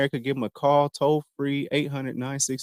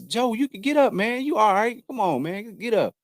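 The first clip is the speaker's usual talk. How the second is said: higher, coaxing self-talk; gentle higher pep talk to himself; inner voice; 7 semitones higher than usual. Retold or imitated. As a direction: urge yourself off the floor, pitched up. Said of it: "That my inner voice is".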